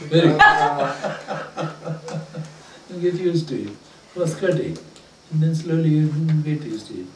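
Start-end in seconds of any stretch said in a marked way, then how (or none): 0.5-2.4 soft burst of laughter; light, amused laugh; real laugh. Real, not acted. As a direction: chuckle freely for a moment. Laughter after the attempt.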